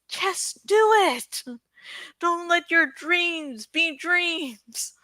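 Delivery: slow, high, intense motivational shout-down; mock motivational intensity, drawn out; Shia LaBeouf impression. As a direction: deliver it like a fired-up motivational speaker. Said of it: "since I'm not Shia LaBeouf".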